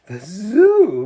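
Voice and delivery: high, loud dismayed cry; upset lady hearing her crocodile goes to the zoo, high and loud; miserable exclamation. A narrator's reading. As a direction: gasp out the shock. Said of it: "exclaimed miserably".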